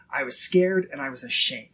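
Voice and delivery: ordinary delivery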